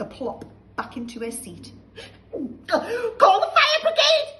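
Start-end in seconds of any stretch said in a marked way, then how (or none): 2.7-4.3 slow, very high, loud screech; panicked shout. Acted. Grandma burning inside, screeching shout. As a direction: shriek for help. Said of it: "she shouted suddenly".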